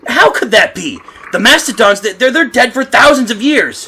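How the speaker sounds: loud, stunned disbelief; shocked outburst; astonished. Acted. Man shocked at seeing mastodons.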